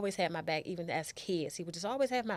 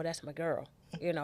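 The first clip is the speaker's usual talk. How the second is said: usual speaking voice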